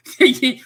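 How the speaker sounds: a loud, high burst of laughter; hearty laugh. Real, not acted. Host laughing at viewer's rock joke, loud burst. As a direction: crack up at the sinking swimmer joke.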